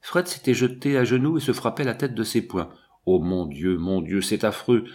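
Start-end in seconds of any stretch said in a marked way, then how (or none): none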